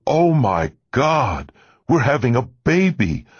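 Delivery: the overjoyed father shouting down the phone; ecstatic shout. A narrator's reading. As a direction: stretch every word up in delight. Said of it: "he shouted at the other end".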